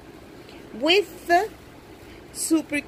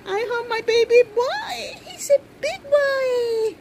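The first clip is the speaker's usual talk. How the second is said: happy tears; 8 semitones higher than usual. Real, not acted. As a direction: voice cracking with joy. Crying with joy.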